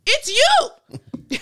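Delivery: high, loud mock accusation; accusing shout. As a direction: point the finger and yell it.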